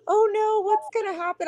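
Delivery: a hammed-up little fret; mock panic. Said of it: "everybody last night was just like".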